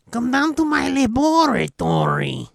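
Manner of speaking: creepy villain voice. Pretend. Mock creepy villain line.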